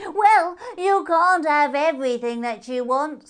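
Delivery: high, loud voice out of nowhere; a strange creature speaking from the dark, high and loud; mysterious voice. A narrator's reading. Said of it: "said a voice suddenly from out of nowhere".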